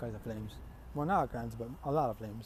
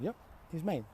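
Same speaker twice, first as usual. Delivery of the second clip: higher, softer matter-of-fact answer; acted casual affirmation; breezy confirmation; 4 semitones higher, 5 dB quieter than usual. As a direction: confirm it lightly.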